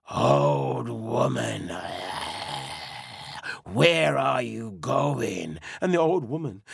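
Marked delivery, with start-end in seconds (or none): 0.1-5.6 low, slow booming bear voice; deep bear. Acted. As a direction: loom over her like a huge bear. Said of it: "The bear said".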